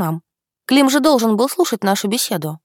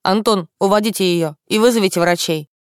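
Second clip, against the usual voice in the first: curt order. A narrator's reading. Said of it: "отрывисто бросил".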